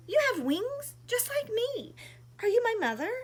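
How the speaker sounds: high, loud little bird's plea; little bird crying out. Acted. Lost little bird crying to the giraffe, high and loud. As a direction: call out like a lost chick. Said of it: "he cried".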